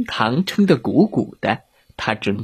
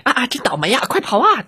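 high, panicked wail; fleeing wolf; 11 semitones higher, faster than usual. Acted. Wolf escaping in his car, high panicked cry. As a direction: wail your bad luck while escaping.

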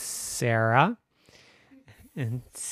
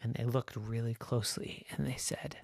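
softer, whispered; hushed narration; 9 dB quieter than usual. A narrator's reading. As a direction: hush as they peer close. Narrator hushing.

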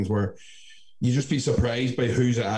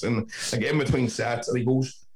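usual speaking voice

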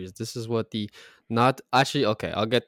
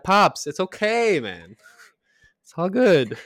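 mock reassuring; 9 semitones higher, 7 dB louder, slower than usual. Son reassuring dad, retold.

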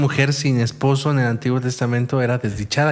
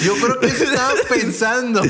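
laughing; 9 semitones higher than usual. Real, not acted. Laughing at the suegra joke.

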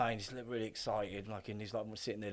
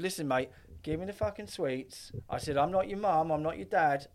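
mock threat; 6 semitones higher, 4 dB louder than usual. Threatening kid over the sweets.

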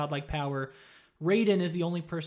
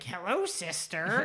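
slow, eerie creature greeting; creepy creature; 2 semitones higher, slower than usual. Retold or imitated. The clone greets Katana, a lightly voiced eerie creature. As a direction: drawl it like a monster waking. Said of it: "the creature on the table gets up".

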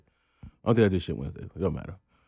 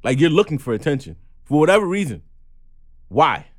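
louder, higher, pressing why; heated demand; 7 semitones higher, 5 dB louder than usual. Real, not acted. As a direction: challenge the person directly. Angry rant at a person.

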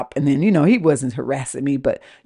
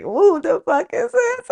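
very high, drawn-out disbelief; exaggerated high-pitched puzzlement; baffled inner thought. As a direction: play up the silent bewilderment, high and slow. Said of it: "in my mind".

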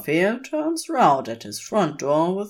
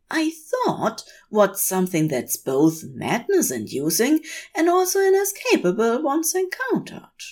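much higher, delighted angel voice; Aziraphale; 6 semitones higher than usual. A narrator's reading. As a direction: greet him with fussy delight. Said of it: "Aziraphale explains".

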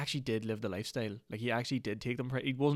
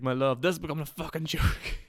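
imitating an exasperated scammer, higher and louder; a higher, louder mock rant at his lover; mock exasperated scold; 6 semitones higher, 5 dB louder than usual. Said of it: "It's like".

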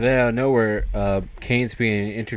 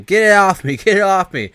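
higher, louder mock scream; retold screaming; 10 semitones higher, 8 dB louder than usual. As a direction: yell for help as the dog bites. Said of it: "hear some screaming".

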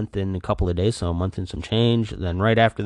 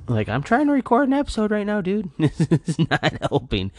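exasperated; 12 semitones higher than usual. Real, not acted. Scolding the dog.

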